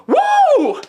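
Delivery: a high, loud triumphant whoop; imitating the pilot's whoop after the stunt; whooping cheer. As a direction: cheer like you just pulled off a stunt. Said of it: "McConaughey goes".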